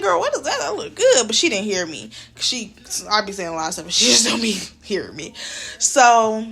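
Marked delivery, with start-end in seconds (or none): 0.0-1.3 put-on high, laughing girl what is that; excited. Asking a coworker about her chocolates, high and laughing. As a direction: gush over the treat with a laugh. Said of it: "I'm like".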